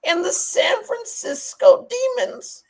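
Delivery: slow, high, over-the-top host intro; Nardwuar impression. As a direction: shout out the team name like Nardwuar introducing a guest. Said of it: "that's my Nardwuar".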